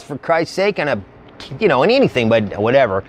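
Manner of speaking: ordinary talk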